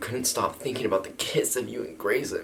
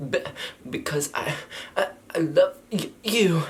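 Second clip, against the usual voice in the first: halting, much higher stammer; nervous love confession; 10 semitones higher than usual. Acted. Girl confessing love to Grayson, hammed-up nervous. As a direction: stammer it out, rising high to the end.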